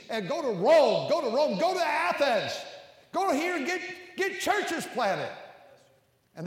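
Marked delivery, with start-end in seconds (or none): 0.1-5.3 loud, high preacher's shout; full-force preaching. Real, not acted. Preacher urging church planters out to the cities, full-force shout. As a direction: shout it out, rallying the congregation.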